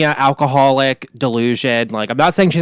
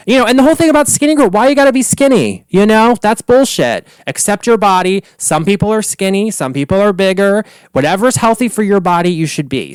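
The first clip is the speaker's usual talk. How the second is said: heated rant; 5 semitones higher than usual. Real, not acted. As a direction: rant at her, worked up. Ranting at Bethany.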